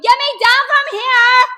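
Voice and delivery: high, drawn-out yell for rescue; shouting. A narrator's reading. Child dangling from crutches demands help, shouting. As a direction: holler it at full volume. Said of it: "I shouted".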